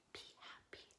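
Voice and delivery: soft whispered echo of the line. Shown as a demonstration. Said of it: "like an echo".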